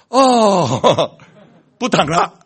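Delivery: loud relieved sigh into a laugh; sudden relief. Acted. Patient suddenly free of pain, loud relief. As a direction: exhale the pain away.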